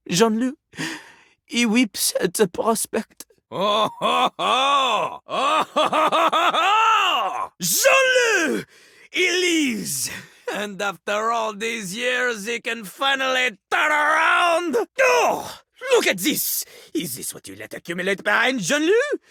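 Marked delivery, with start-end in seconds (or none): 3.5-7.5 hammy sobbing oh-ho-ho rising higher; overdone French weeping; mock wailing. Weeping at the prospect. 7.6-16.6 high triumphant cry; hammed-up joyful exclaim; excited shout.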